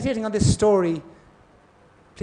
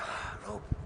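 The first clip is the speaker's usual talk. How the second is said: whispered; 3 semitones higher, 13 dB quieter than usual. Retold or imitated. Spotting the rope, whispered.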